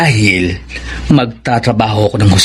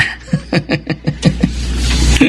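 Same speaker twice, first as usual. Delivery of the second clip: put-on soft, smug drunken chuckle; quiet self-satisfied snicker; tipsy chuckle; 3 semitones higher, 4 dB quieter than usual. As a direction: laugh quietly at his own luck. Tipsy farmhand bragging to father.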